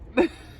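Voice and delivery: short high giggle; quick real giggle. Real, not acted. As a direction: let a little laugh slip. Laughs after unsayable joke.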